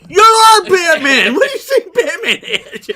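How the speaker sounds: high, loud outburst breaking into laughter; incredulous shout. Acted. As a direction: yell in disbelief at the absurd line. Mock outrage at a silly line.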